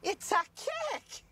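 high, slow squeal of it's a cake; mock realization. Friend replays the film mom's discovery, high and slow. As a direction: stretch out cake like a sudden realization. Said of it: "In a cakey body".

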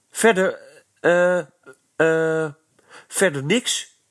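high, halting stammer with hesitations. Acted. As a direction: stutter it out, stuck for words. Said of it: "stotterde Eddy".

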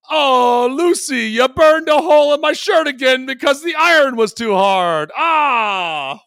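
put-on high, loud Cuban accent shouted with exasperation; bad Ricky Ricardo impression. Co-host yelling at Lucy in a bad Cuban accent, loud. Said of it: "are you trying to be Rookie Ricardo?".

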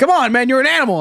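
high-pitched, goading cheer; hyping him up. Retold or imitated. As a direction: pump up a reluctant friend. Acts out friends egging him on.

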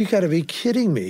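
acted astonished outburst; incredulous. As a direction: blurt it out, unable to believe it. Acted shock at friend's news.

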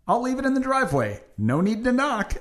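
high, fast, chirpy note voice; sing-song doorstep note; cheerful delivery note. Retold or imitated. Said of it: "my new method is".

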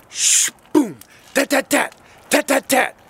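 mouth-made boom and rattling gunshots; imitated gunfire. Father imitating gunfire heard over the phone, mouth sounds. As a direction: spit out the tat tat like rifle fire. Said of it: "I hear a shh".

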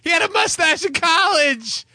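high-pitched, insistent rant; hammed-up outburst. Host ranting about his co-host, hammed-up outburst. Acted quotes in show's cold open.

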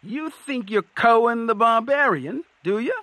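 high-pitched troll challenge; sceptical troll. A narrator's reading. Troll doubting the old man is Cohen, high and challenging. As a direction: question him in a high voice.

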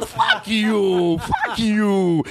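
slow, drawn-out indignant mimicry; imitating his voice. Comedian voicing the man's rant at a customer, slow indignant imitation. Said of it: "do him doing that voice".

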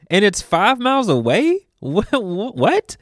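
incredulous commuter asking about bus times; mock disbelief. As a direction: play the stunned ex-New Yorker doing the commute math. Imagined commuter shocked by transit.

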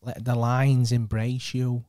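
loud, very slow Manc character; drawn-out, hammed-up Manc; put-on Manc accent. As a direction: try a big Manc voice, stretching every word. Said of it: "let me get into character".

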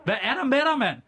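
high, exasperated calling after him; mock upset. Host calling after his walking-out cohost, mock upset.

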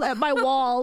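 ordinary talk, nothing marked

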